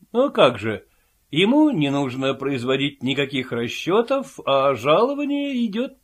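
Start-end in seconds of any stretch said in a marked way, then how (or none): none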